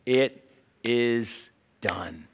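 slow, weighty divine pronouncement; a slow heavenly voice declaring the end; thundering voice. Acted. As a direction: let each word land like thunder. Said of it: "this voice comes thundering through".

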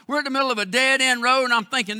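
ordinary delivery

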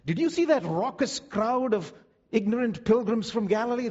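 high-pitched, indignant contempt; scornful priest. Acted. Temple priest complaining to colleagues about the pilgrim crowd, indignant.

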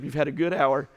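usual speaking voice

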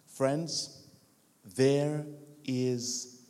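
low, softened, earnest preaching; spaced-out phrases, gently building a litany; solemn altar appeal. Real, not acted.

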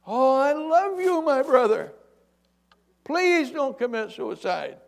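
high, loud, slow stretched holler; acted long-distance plea; yelling up. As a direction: call up earnestly to someone far above. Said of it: "He yells up at him".

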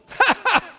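high burst of derisive laughter; mocking laugh. Preacher showing God laughing in derision. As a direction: laugh down at the rebels.

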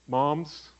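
ordinary talk

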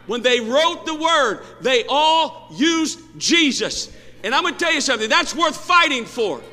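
high, very loud shouted sermon, building in intensity; full-force preaching. Real, not acted. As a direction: shout it out to the whole congregation.

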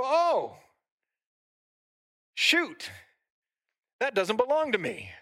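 sudden high "Oh, shoot!" that settles back down; a comic double take at the mirror; mock shock. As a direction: jumps high, then drops to a sheepish admission. Mock shock at own reflection.

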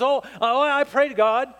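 lightly hammed-up hasty assurance; mock flustered answer. Same stranger answering the pastor.

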